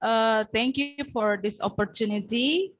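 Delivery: raising the voice for a bad line; projecting so the room can hear. Real, not acted. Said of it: "Speak louder, please".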